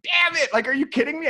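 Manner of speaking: high, loud mock frustration; learning the star checked out, a hammed-up groan of annoyance; frustrated outburst. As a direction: blurt it out, annoyed at missing him. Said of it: "And I'm like".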